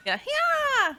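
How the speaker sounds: high, loud sword-attack yelp; playful hammed-up video game hero yell; mock Link battle cry. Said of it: "Much like Link".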